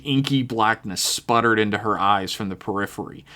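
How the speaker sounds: ordinary delivery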